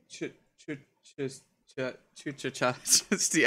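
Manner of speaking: stammering over CH at the start of the name; halting attempts at an unfamiliar name; tongue-tied stumbling. Real, not acted. Commentator fighting a weird team name, tongue-tied. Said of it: "weird name just to give me a hard time".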